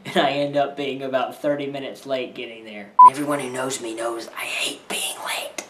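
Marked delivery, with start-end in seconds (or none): 3.0-5.7 whispering. Real, not acted.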